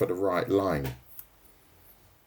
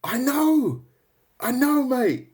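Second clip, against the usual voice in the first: much higher, louder burst of delight; excited exclamation; 3 dB louder, slower than usual. Real, not acted. Streamer answering a chat guess, excitedly high and loud. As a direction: yelp it out like you've been caught out.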